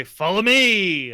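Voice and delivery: loud call. Acted. Character calls out to group.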